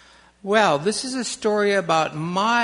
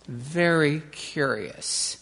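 usual speaking voice

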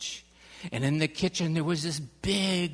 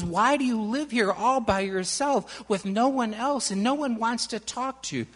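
put-on innocent child; 8 semitones higher, 7 dB louder than usual. A narrator's reading. Annie asks innocently.

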